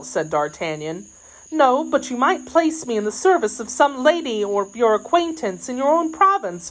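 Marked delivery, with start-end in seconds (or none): 1.5-6.7 higher-pitched, hopeful maid's voice; pleading maid. Acted. Maid asking for a place in some lady's service, pleading. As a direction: suggest it in a high, pleading voice.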